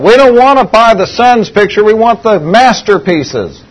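loud impatient yell from the crowd. Retold or imitated. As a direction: shout it out, impatient bidder. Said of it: "Some guy yelled out".

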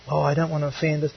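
mumbled aside, soft and quick; quick, hushed mock-timid excuse; timid objector. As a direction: imitate someone backing away from trouble, fast and softly.

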